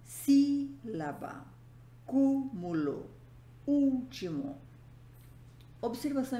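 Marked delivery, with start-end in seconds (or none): 0.0-4.6 "sílaba", "cúmulo", "último" with first syllable high and drawn out; stress on antepenultimate syllable. Shown as a demonstration. Teacher giving proparoxytone examples, first syllable stressed high. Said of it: "antepenúltima sílaba tônica".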